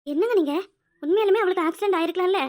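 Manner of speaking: loud protest. Acted. Wife indignantly protesting husband's coldness. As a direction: push back hard, shocked.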